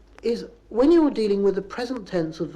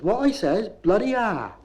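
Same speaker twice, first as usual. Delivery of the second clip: a touch raised and emphatic; mildly cross retort; 4 semitones higher, 4 dB louder, slower than usual. Acted. Navvy answers workmate after a row, emphatic cross retort. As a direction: swear back stubbornly.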